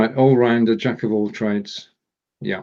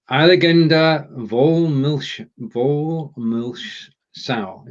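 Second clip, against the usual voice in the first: slow, halting attempt at German; halting read; 3 semitones higher, much slower than usual. Real, not acted. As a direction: sound out an unfamiliar phrase, drawn out. Reading unfamiliar German phrase haltingly.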